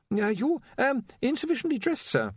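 high, stammering, anxious voice; nervous young wizard. Acted. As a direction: stumble over the words nervously.

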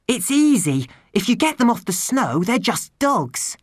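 put-on a girl catching her breath, relieved; Tiffany, girl's voice. A narrator's reading.

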